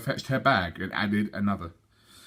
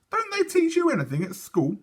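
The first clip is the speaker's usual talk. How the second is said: put-on higher, exasperated little girl; lightly voiced know-it-all; scornful girl. Sophie mocking the twins, mild scorn.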